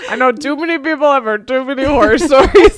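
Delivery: loud, high laughing sob; cry-laugh it out; laughing through tears. Real, not acted. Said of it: "I'm, like, snotty".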